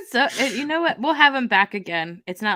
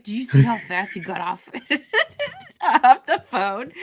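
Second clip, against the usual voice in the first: laughing quote; 5 semitones higher, 4 dB louder than usual. Retold or imitated. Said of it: "I was like".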